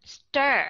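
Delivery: "Stir" with a full, distinct er vowel; clear ER. Shown as a demonstration. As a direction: hit "Stir" firmly, no schwa. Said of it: "rõ ràng phải không".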